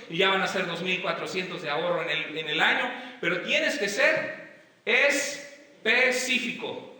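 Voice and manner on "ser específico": "específico" broken into slow, separate pieces; emphatic word break. Real, not acted. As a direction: hammer "específico" piece by piece, pitched up. Preacher hammers his key requirement.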